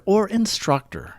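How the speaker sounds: ordinary talk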